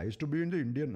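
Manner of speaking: hammed-up Indian accent, quick and high; American-style Indian accent. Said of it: "That's such an American Indian accent".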